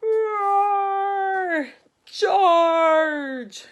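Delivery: loud, high roaring battle cry. Acted. Knights and dragon clash, loud roaring charge. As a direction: bellow it like a charging army.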